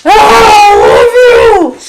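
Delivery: high, loud, slow shout of love; gushing shout. Real, not acted. Owner shouting her love at the dog. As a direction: burst out adoring the dog.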